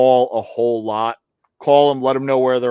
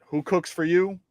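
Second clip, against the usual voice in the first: soft demo of the barred owl rhythm; owl hoot cadence; 3 semitones higher, 7 dB quieter than usual. Shown as a demonstration. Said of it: "Follow that cadence".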